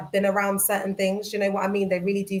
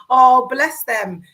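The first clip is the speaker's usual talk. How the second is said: mock pity; 3 semitones higher, 9 dB louder, much slower than usual. Mocking young men at uni.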